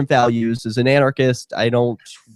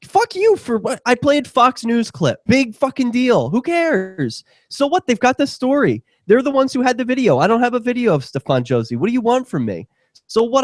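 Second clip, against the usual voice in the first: exasperated rant, much higher pitch, jabbing each question; venting real irritation at a critic, pitched way up; mad rant; 9 semitones higher than usual. Real, not acted. Radio host snaps back at a complaining listener, exasperated and high-pitched. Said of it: "I'm mad at one of our listeners".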